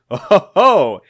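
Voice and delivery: loud acted "oh ho ho"; villainous chuckle. Eggman relishes attacking the Sky Patrol, villain chuckle. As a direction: gloat with a rounded villain laugh.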